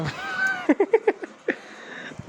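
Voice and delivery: high, bright laugh that falls away; laughing out loud at mom; burst of laughter. Real, not acted. Laughs at mom breaking fast.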